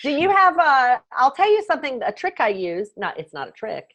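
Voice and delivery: ordinary talk, nothing marked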